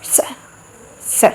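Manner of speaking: "स" as "ṣa", tongue flicked up; retroflex sibilant. Shown as a demonstration. Teacher shows where the tongue strikes, retroflex "ṣa" demo on "स". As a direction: curl the tongue up sharply for "स" as "ṣa". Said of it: "वो झटके से ऊपर जाके लगेगी".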